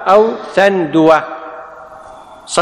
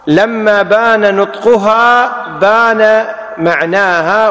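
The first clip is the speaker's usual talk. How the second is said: slowed for emphasis; 2 semitones higher, much slower than usual. Shown as a demonstration. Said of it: "emphatic slow principle".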